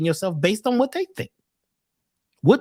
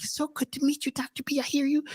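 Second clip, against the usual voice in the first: high, soft, put-on sugary caller; mock the fake-nice phone voice; fake sweet voice; 7 semitones higher, 5 dB quieter than usual. Lady on the phone greets Doctor B in a high, soft fake-sweet voice. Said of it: "They get a voice. It's a special voice".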